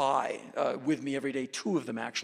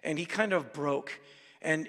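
ordinary talk

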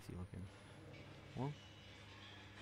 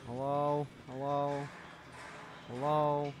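calling out; 4 semitones higher, slower than usual. Real, not acted. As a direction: call out to someone hiding. Calling out to game character.

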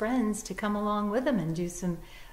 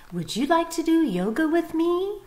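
higher, hopeful bunny invitation; eager bunny; 10 semitones higher, 7 dB louder than usual. Acted. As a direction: ask your friend to join, all hope.

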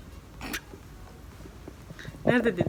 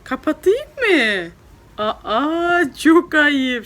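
higher and louder, playful mock scold; 5 semitones higher, 8 dB louder, much slower than usual. Mother pretending to be shocked her daughter wants it off.

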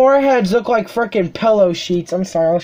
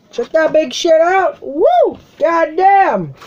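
loud, high whoops of delight; real excited cheering; excited shouting; 9 semitones higher, 4 dB louder, slower than usual. Real, not acted. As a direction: yell it out, thrilled by the huge box. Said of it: "Sorry, I'll shut up".

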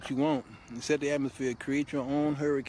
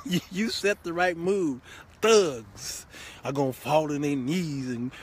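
usual speaking voice

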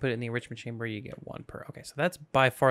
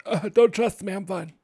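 higher, louder, mock-ominous don't trust me; a hammed-up warning, higher and louder; 4 semitones higher, 5 dB louder than usual. Shown as a demonstration. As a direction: warn them off with a playful push on don't.

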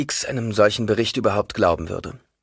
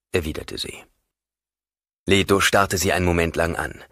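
ordinary delivery

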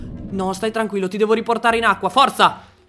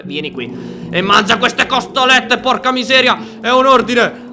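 loud, rising-pitch command; exasperated order; 3 semitones higher, 5 dB louder than usual. Real, not acted. Tamer orders the stubborn dragon to eat, exasperated. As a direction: order it like a fed-up pet owner.

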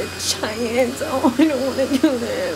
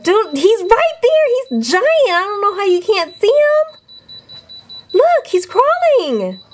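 higher, louder, urging someone to look; urgent alarm; 8 semitones higher, 4 dB louder than usual. Real, not acted. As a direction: point and insist. Urgent pointing at spider.

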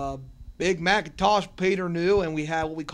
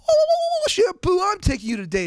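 very high, much louder gushing reply; hammed-up swoon; 11 dB louder than usual. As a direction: gush it like a lovestruck fan. His reply to the voice actress.

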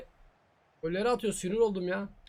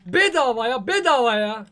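louder, exasperated repeat; exasperated yell; 6 dB louder than usual. Real, not acted. Gamer yelling at the easy goal, exasperated. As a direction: shout it out in disbelief.

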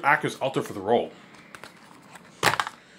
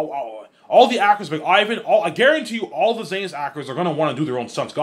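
usual speaking voice

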